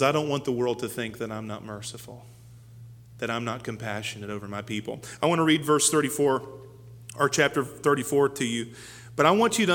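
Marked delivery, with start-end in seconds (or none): 0.0-5.0 God's voice. Acted. God answers Moses, voiced.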